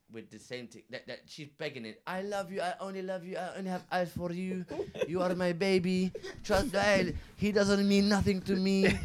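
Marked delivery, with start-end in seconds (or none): 2.1-9.0 mock pleading girlfriend. Drunk girl begging boyfriend.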